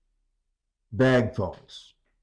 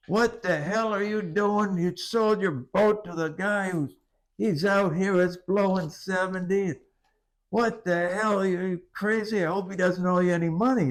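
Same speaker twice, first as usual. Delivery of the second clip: higher, incredulous scolding friend; alarmed caller; 9 semitones higher than usual. Retold or imitated. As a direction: raise the pitch, scold in disbelief. Caller retells alarmed friend's rant.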